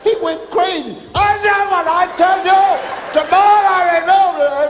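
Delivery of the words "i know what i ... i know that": high, loud ranting; furious instructor. Retold or imitated. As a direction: rant at the stunned recruits. Instructor going crazy.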